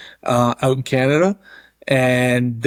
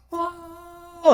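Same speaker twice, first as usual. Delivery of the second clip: high, soft astonished oh; a hushed, shocked gasp pitched way up; stunned gasp; 6 dB quieter than usual. Retold or imitated. Locker room reacts to suplex.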